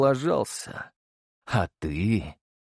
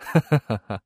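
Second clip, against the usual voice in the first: rumbling laugh. Acted. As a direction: laugh like a powerful ruler. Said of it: "Его ракочущий смех пронёсся по залу".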